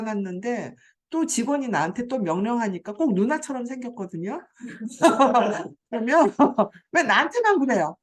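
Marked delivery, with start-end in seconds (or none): 4.6-6.8 real laugh spilling into words; laugh, then carry on talking; laughing at the guardian story. Real, not acted. 6.9-7.9 mock huffy complaint, louder and fast; imitating an irritated guardian, louder and rushed; irritated outburst. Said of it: "신경질을 펑 내고".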